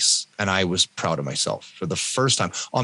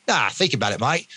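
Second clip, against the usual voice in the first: put-on amateur Australian accent, casual coaxing; 3 semitones higher than usual. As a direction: imitate an Aussie mate persuading a friend. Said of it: "I won't do the Australian accent anymore".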